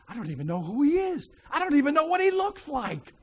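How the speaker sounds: retold exasperation, pitch climbing high; exasperated prayer. Man praying in the elevator about meeting a stranger, exasperated. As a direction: voice rising as he protests to God.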